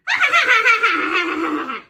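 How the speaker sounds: high, loud cackle; witch cackle. Pretend. Witch delights in tormenting her servants, loud cackle. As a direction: cackle gleefully at your servants. Witch enjoys bossing players around.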